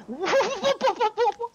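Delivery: high burst of laughter. Real, not acted. Laughing at astonishing moment.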